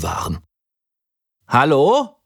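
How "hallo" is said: high call into the dark. A narrator's reading. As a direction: call out into the night. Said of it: "rief ich".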